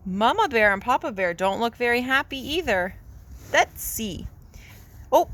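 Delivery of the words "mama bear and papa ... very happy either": plain reading voice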